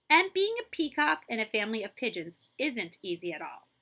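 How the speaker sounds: bright, lively storybook reading; animated read-aloud for kids; reading to children. A narrator's reading. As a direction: read it like a picture book to a class.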